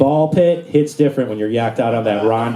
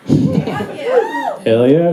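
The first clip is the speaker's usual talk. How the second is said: very high hyped squeal; hype shout. Real, not acted. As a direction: hype up the crowd. Hyping TikTok crowd.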